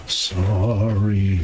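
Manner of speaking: a faint, distant ghostly apology. Acted. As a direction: call it out like a far-off ghost. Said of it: "then you hear a ghostly voice".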